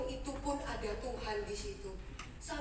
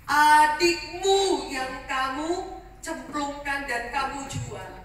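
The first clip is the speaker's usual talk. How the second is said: loud and slow, word by word; solemn reveal; 13 dB louder, slower than usual. Acted. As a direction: lay the betrayal bare, slowly. Joseph reveals himself.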